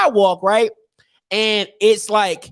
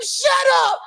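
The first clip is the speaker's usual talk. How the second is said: put-on high-pitched kid outburst; comic tearful shrieking; mock screaming. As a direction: wail it at a meddling stranger, hammed-up. Acting child yelling at a stranger.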